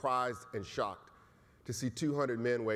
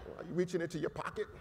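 laughing mock alarm; 5 semitones higher, 4 dB quieter, faster than usual.

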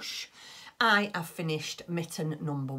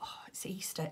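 whispered realisation; 2 semitones higher, 8 dB quieter than usual. Retold or imitated. Remembering it's Easter, to herself.

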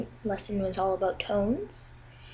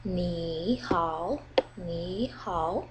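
"Ni" and "hao" each dipping low then rising; 3 semitones lower, 4 dB quieter, much slower than usual. Shown as a demonstration. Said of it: "and then it goes up".

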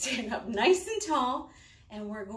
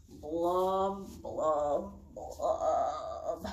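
blub lower each time; 5 semitones lower, much slower than usual. Shown as a demonstration. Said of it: "get lower and lower every time".

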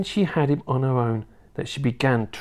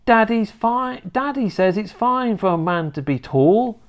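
put-on high, earnest boy's voice; protesting little boy; 10 semitones higher than usual. George reasoning with his grandma, protesting. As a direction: plead the point in a high child voice.

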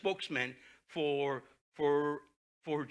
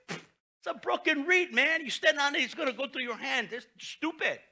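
high, loud scornful jeer; scornful paraphrase, pitched high; mocking contempt; 12 semitones higher, 6 dB louder than usual. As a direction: sneer at their trust in Egypt. Rabshakeh mocks, paraphrased.

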